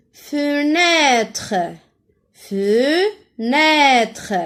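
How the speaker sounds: Fenêtre drawn out with a long open ê. Shown as a demonstration. Teacher tests pupils for the é sound, long open ê in Fenêtre. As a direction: stretch the second syllable of Fenêtre as open è. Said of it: "Ce n'est pas E".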